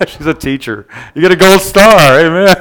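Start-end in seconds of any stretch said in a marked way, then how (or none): 1.2-2.6 higher, playful praise breaking into laughter; higher pitch, tipping into a real laugh; amused teasing. Real, not acted. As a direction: tease them with mock-grand praise, then crack up. Jokes with congregation, then laughs.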